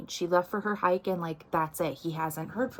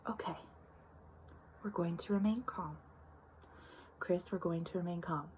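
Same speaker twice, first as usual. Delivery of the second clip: calm whisper; 10 dB quieter than usual. Retold or imitated. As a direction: hush the room, keep it even. Friend calming panicked family.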